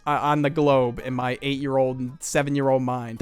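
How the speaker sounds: ordinary talk, nothing marked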